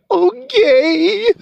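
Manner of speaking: very high, drawn-out mock whimper; tearful toddler. Little son reluctantly accepting dad's no, high tearful imitation. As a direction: imitate a crying child giving in. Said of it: "That's literally what he said".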